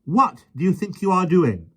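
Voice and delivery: high, loud scolding question. A narrator's reading. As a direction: scold the naughty dog. Mary scolding the lion.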